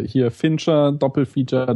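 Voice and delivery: usual speaking voice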